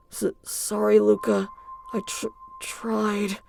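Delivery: very soft, slow, failing voice; faint, weak apology. Acted. Injured Luca apologizes to Adrian, faint and fading. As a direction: barely get the words out.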